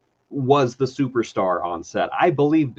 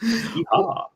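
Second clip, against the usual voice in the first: cowboy yeehaw; 10 semitones higher than usual. Pretend. Cowboy call.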